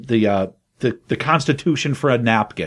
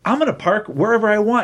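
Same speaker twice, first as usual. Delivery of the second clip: higher, exasperated retort; 8 semitones higher than usual. Retold or imitated. Man answering the woman about parking, pitched up. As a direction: toss it back, pitched up and fed up. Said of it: "He's like".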